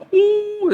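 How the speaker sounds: long high-pitched mock wail; imitated crying wail. Son mimicking widowed father crying on the bed, mock wail. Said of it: "Duk atas katil teriak".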